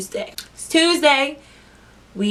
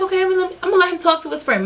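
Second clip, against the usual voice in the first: mock breezy; 9 semitones higher, faster than usual. Drunk self, casual, acting unbothered.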